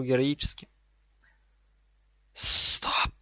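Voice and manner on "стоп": forceful whispered halt; louder snake hiss. Acted. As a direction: hiss it sharply without voice. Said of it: "Голос змеи уже стал громче".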